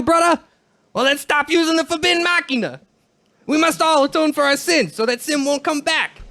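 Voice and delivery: high, hammed-up fake Jamaican accent; bad Jamaican accent. Podcaster playing Wakka preaching against Machina, bad Jamaican accent. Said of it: "do a really, really shitty Jamaican accent".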